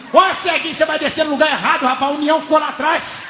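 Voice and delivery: high-pitched, hurried holler; urgent warning. Retold or imitated. Man behind warns blind passenger, high urgent call. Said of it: "ele me puxou pela camisa".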